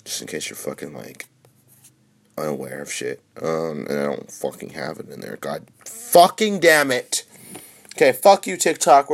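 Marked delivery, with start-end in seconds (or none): 5.4-9.1 high, loud exasperated cursing; angry outburst. Real, not acted. Streamer swearing at TikTok over a login, angry outburst. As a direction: curse the app out, voice jumping up.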